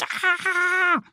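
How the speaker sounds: a soft mock fright cry; startled duck scream. A narrator's reading. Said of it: "bekommt einen Riesenschreck und schreit".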